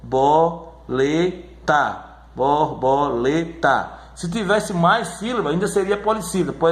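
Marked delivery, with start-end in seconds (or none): 0.0-2.0 slow, separated bor-bo-le-ta; syllable by syllable. Shown as a demonstration. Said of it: "você divide a sílaba". 2.3-3.9 slow, even bor-bo-le-ta; teacher dividing "Borboleta" slowly; syllable by syllable. Shown as a demonstration. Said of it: "você divide a sílaba".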